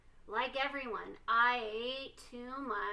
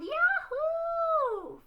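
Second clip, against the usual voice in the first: very high, loud whoop of delight; joyful yell; 8 dB louder than usual. Acted. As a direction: cheer it out at the top of your voice. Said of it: "we yelled".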